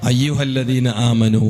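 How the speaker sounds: low, slow intoned verse; Quranic recitation. Acted. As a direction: draw out the final vowel solemnly. (താഴ്ന്ന സ്വരത്തിൽ നീട്ടിയ ഖുർആൻ പാരായണം)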